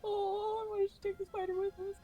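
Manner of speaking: high, lightly put-on voice; high character voice. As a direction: speak in a high role voice.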